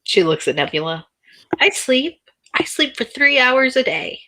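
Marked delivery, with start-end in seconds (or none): 1.6-4.2 high-pitched defensive protest; indignant, higher character voice; defensive Nebula's protest. Acted. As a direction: insist on it, pitched well up. Nebula protests she does sleep.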